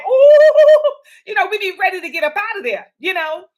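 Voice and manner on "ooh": high, loud squealing "ooh"; a high, laughing "ooh" of relief; eager squeal. Pretend. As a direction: squeal like it's quitting time. Clock-watching at four fifty-nine.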